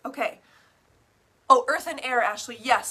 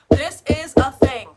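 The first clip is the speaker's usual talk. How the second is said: emphatic; 14 dB louder than usual. Real, not acted.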